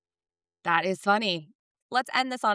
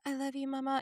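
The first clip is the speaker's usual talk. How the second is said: sleepy whisper-soft; 7 semitones higher, 7 dB quieter, faster than usual. Retold or imitated. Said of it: "It was just like".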